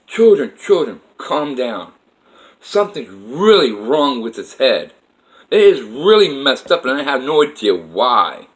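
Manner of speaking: put-on loud, commanding address to children; God's voice. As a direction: play God calling out over a crowd. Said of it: "I hear God's voice again saying".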